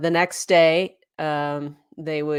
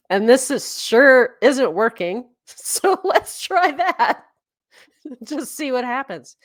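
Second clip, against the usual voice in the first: louder, higher, laughing through it; giddy laugh at the idea of quitting; laughing reply; 6 semitones higher, 7 dB louder than usual. Retold or imitated. Cleo agrees to quit, laughing loudly.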